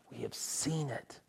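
awed whispering testimony; hushed awe. Acted. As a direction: say it quietly, full of wonder. Repeat, hushed.

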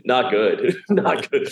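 amused, chuckling reply; laughing admission. Real, not acted. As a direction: admit it through real laughter. Laughing about a bad show.

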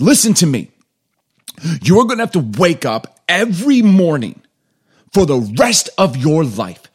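loud, high stern dad lecture; dad losing it. Retold or imitated. Dad scolds sleepy son at bedside, loud stern rant. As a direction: lay down the law to a lazy kid. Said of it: "were on one, let me tell you".

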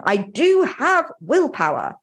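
firm, convinced self-affirmation; with conviction. Shown as a demonstration. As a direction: declare it like you believe it. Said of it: "And say it with conviction".

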